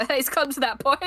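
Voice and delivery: normal talking voice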